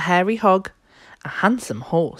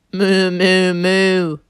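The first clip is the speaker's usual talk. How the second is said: loud, long, higher moos; mooing; 4 semitones higher, 5 dB louder, much slower than usual. A narrator's reading. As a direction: moo three times like a cow. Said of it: "the duck on the pond said".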